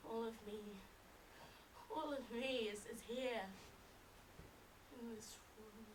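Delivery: hushed resignation with long pauses; desolate and drained. Acted. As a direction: let the words fade out, emptied of life. Vision gone, feels dead and empty.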